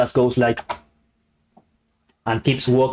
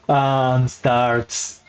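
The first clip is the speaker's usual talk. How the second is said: normal talking voice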